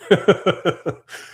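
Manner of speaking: short loud laugh, falling away; loud amused laugh; real laugh. Real, not acted. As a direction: burst out laughing at the chat. Laughing at viewer's refusal.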